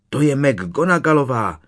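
usual speaking voice

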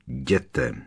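low, menacing command; a low-voiced warning to leave; threatening. A narrator's reading. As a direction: order him off with a low threat. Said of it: "a jeho hlas zněl výhrůžně".

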